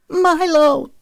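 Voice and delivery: high-pitched scream of his name. A narrator's reading. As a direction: scream out for a lost child. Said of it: "Zoe screamed".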